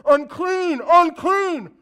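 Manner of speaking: high, very loud warning cry; lepers calling out. Retold or imitated. Leper warning the town crowd, loud high cry. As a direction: cry it out so people keep away. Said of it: "they have to call out".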